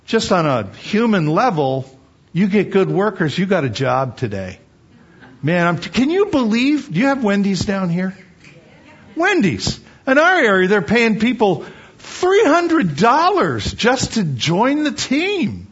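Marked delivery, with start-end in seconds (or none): 5.4-15.7 amazed disbelief. Real, not acted. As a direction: sound astonished at the bonus. Amazed at Wendy's hiring bonus.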